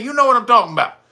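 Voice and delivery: normal talking voice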